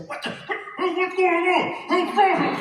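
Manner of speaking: hammed-up baffled shout under the cape; confused yelling. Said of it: "you hear [name] go".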